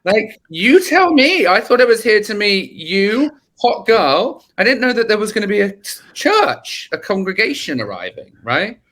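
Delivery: retelling a baffled outburst at Kyle; exasperated rant. Retold or imitated. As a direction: vent the disbelief at your mate. Retold exasperated outburst to friend.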